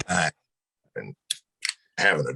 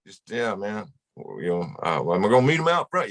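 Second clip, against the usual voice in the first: a lightly voiced, higher, loose buddy; buzzed Dave; 10 semitones higher than usual. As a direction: play the tipsy pal going along with it.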